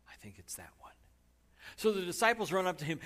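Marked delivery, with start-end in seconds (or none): 0.1-0.9 hushed aside to the congregation; whisper. Real, not acted. Preacher whispering an aside to the congregation. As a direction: drop to a breathy whisper.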